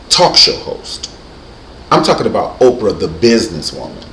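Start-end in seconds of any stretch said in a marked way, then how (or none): none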